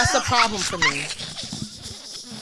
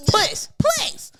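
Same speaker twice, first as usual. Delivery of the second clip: high exasperated please. Real, not acted. Exasperated dismissal.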